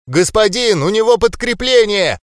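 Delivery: high, loud alarmed yell. A narrator's reading. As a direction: shout the warning to the lord. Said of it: "заорала какая-то гномиха".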